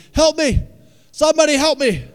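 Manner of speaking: desperate high-pitched shout; screamed cry for help. Acted. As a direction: scream for rescue at the top of your voice. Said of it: "He screamed out with a loud voice".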